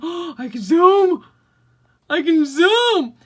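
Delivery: loud, high, drawn-out exclamation; gasp, then crow it in delight; excited discovery. Real, not acted. Vlogger discovering she can zoom the camera, thrilled and loud.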